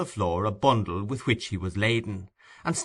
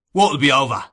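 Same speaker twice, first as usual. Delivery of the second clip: louder, savage snarl; 4 semitones higher, 8 dB louder than usual. A narrator's reading. Sykes savagely demands what Nancy means, harsh. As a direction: snap it savagely. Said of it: "in a savage voice".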